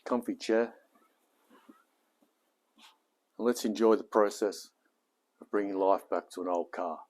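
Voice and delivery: upbeat presenter sign-off read; cheerful video host intro; YouTube intro. Acted. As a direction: pitch it like a channel host welcoming viewers. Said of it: "That, that was for YouTube".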